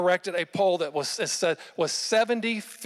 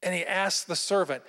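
ordinary delivery

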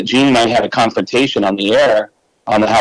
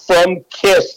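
[name] impression; 8 semitones higher than usual. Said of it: "He actually said".

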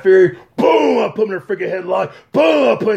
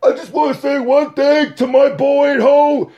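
high, yelling victory call; triumphant shout; 7 semitones higher than usual. Retold or imitated. Champion shouting to Alan at home, triumphant. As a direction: shout it to your boy at home. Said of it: "he's looking at Alan".

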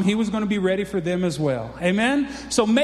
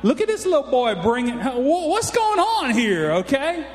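nervous giant; 5 semitones higher than usual. Retold or imitated. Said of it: "I believe he's like".

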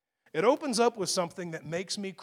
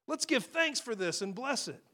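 a bright, much higher "Hallelujah" that settles into a thankful blessing; joyful praise; 7 semitones higher than usual. Retold or imitated. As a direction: cheerfully thank God for the little food you've been handed. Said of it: "he's like".

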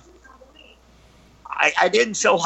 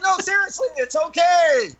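high, raised call over the panic; insistent calling; 12 semitones higher than usual. Retold or imitated. Storyteller pleading with fleeing kids, high insistent call.

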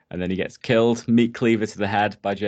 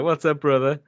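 put-on girl's greeting; 6 semitones higher, 5 dB louder than usual. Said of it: "she's like".

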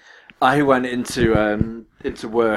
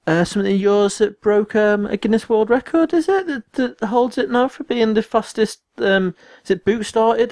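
imitating mum; 11 semitones higher than usual. Mum recalling a news item, high and unsure. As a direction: put on a hesitant mum, pitched well up.

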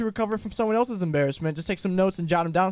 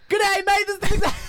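frantic high, loud Aussie greeting; runaway projecting; 13 semitones higher, 5 dB louder than usual. Pretend. Host stuck on his intro, runaway projecting. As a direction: let the intro spiral up out of control. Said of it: "We can't stop him".

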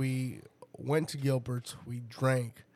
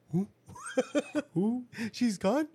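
higher, eager questions; mock eager; 8 semitones higher than usual. Teasing friend about his eager reaction, higher. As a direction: perk up like good news. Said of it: "You're like".

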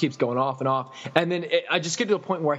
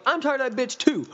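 boss imitation; 10 semitones higher than usual. Mimics the boss agreeing.

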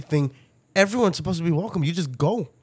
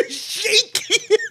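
much higher, broken by laughter; real laughter mocking friend; laughing tease; much slower than usual. Real, not acted. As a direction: squeal it through giggles. Teasing through laughter.

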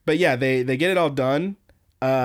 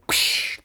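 voiceless "psh" crash effect; imitated crash noise. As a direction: make a hissing smash sound with the mouth. Imitating a crash through window.

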